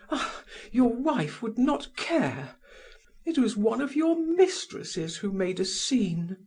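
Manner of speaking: high, bitter woman's voice; scorned lover, high and reproachful; jealous mistress. A narrator's reading. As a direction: needle him about his mistresses. Held Madame Walter voice.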